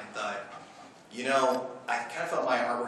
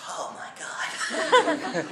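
awed whisper; much slower than usual. Retold or imitated. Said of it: "drawn into their pictures, stunned".

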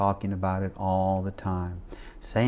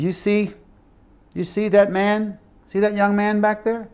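raised, much higher retold questioning; pointing someone out; 10 semitones higher, 4 dB louder than usual. Preacher shows the pastor a young man, voice raised high. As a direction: lift the pitch as if singling someone out.

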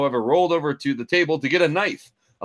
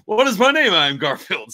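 higher, louder hammed hero line; moving voice; 8 semitones higher, 6 dB louder than usual. Narrator redoing Garfield's line, mock moving voice. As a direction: deliver it as a grand stirring declaration. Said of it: "said he with moving voice".

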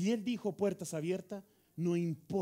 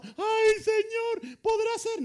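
high, loud, mock pleading; exaggerated timid begging, pitched way up; doubting supplicant; 14 semitones higher, 7 dB louder, much slower than usual. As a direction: wail it like a hammed-up, unsure prayer. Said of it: "Entonces no vienes al trono".

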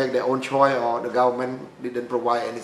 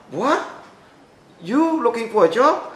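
much higher, incredulous "What?" then a rising question; shocked disbelief; 9 semitones higher, 3 dB louder than usual. Retold or imitated. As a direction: retell her stunned double take. Director shocked at shabby applicant.

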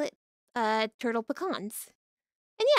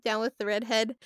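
normal talking voice

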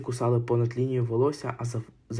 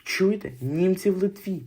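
higher, slower, joyful news shouted; mother telling the wagon, lifted and thrilled; mildly raised happy announcement; excited cry; 6 semitones higher, slower than usual. Acted. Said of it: "крикнула вона".

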